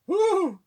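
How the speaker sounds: very high, loud falsetto woo; delighted whoop. Retold or imitated. Posh woman groped at dinner, a high loud pleased whoop. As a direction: coo like a thrilled posh lady. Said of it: "she's like, rather".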